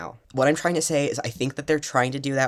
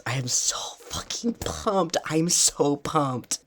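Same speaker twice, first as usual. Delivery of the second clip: excited whisper. Real, not acted.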